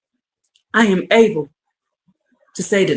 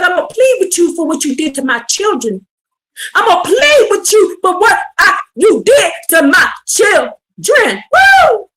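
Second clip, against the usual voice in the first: preaching at full force; 9 semitones higher than usual. Acted. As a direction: whoop and hammer it at the sinners. Preacher quoting God, rising.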